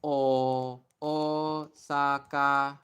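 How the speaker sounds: Osaka drawn out very slowly, long O held. Shown as a demonstration. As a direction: spell out Osaka syllable by syllable for viewers. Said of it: "I'm gonna say it really slowly".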